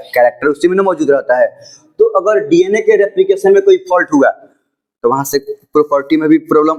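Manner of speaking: ordinary delivery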